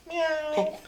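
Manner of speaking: high, soft little meow; high-pitched kitty mew; cat meow. Retold or imitated. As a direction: mew quietly like a kitten. Answering instructors as face-painted kitty.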